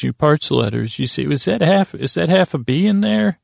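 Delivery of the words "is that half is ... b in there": high-pitched, puzzled query; puzzled questioner. Acted. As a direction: pitch it up as a baffled interviewer. Imagined interviewer puzzles over letters.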